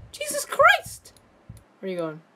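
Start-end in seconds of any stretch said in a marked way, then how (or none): 0.1-1.1 high, drawn-out startled cry. Real, not acted. Player jumps at the monster, slow startled cry. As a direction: caught off guard, cry it out slowly.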